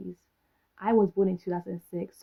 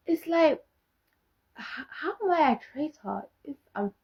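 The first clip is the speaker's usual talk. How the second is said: exasperated disbelief; 7 semitones higher than usual. Real, not acted. Exasperated at insult of traitor.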